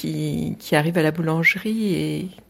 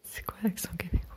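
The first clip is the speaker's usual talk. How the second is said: whispering; 12 dB quieter, much faster than usual. Real, not acted. Whispered reply to accent request.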